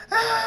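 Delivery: very high, loud, long shriek; scream. A narrator's reading. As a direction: scream high as the shot hits. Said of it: "Project Seven screams".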